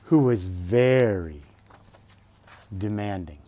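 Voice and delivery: bright, lifted storybook voice; reading to children. Acted. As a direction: read it out like a bedtime book. Reading picture book aloud.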